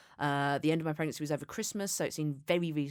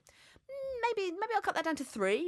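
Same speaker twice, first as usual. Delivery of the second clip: musing to herself; 12 semitones higher than usual. Retold or imitated. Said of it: "I was like".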